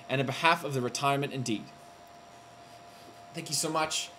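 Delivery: ordinary talk, nothing marked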